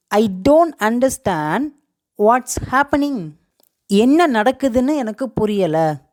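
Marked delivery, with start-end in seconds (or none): 0.1-3.3 slow, careful, split into two halves; slow model sentence. Shown as a demonstration. Teacher models English for confusion, slow and deliberate. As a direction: enunciate slowly, pausing midway for learners.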